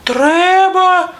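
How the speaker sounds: very high squeaky mouse voice; little mice. A narrator's reading. Mice tell the rooster what's needed, squeaky voice. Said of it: "кажуть".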